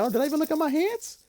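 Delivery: high surprised inner voice. Retold or imitated. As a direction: squeak out the thought quickly. Retelling a trip, inner thought.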